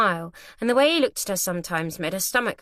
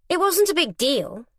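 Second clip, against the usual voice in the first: Arabella's line; 9 semitones higher, 4 dB louder than usual. A narrator's reading. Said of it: "Arabella said".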